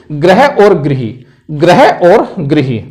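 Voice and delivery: ग्रह और गृही said slowly, ग्रह with gra and गृही with gri; careful slow contrast. Shown as a demonstration. Teacher to class, slowly contrasting ग्रह and गृही. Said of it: "रिरी का प्रयोग है यहां".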